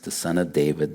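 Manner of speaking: ordinary talk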